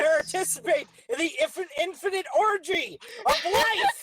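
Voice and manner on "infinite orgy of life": mock grand proclamation.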